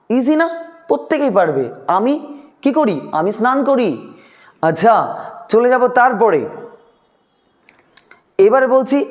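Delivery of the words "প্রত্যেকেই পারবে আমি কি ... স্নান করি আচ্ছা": ordinary talk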